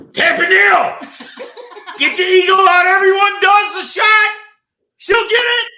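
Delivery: loud, high hoarse bellow; yell. Acted. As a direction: yell it like a drill sergeant. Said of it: "How about if I just yell?".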